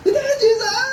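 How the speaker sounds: acted desperate high-pitched cry. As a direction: defy the hushing and call out for help. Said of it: "Lalo siyang sumigaw".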